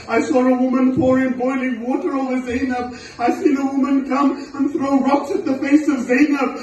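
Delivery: forceful voice climbing to a high wail; impassioned lament preaching. A narrator's reading. Preacher recounting the attacks on Zainab, impassioned lament. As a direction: build steadily from loud incitement into high anguish.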